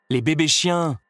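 insisting. A narrator's reading. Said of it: "insista Cruella".

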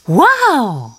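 high, drawn-out wow; amazed exclamation. A narrator's reading. Timon sees all the fish, high amazed cry. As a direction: let it swoop up in amazement. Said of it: "s'écria Timon".

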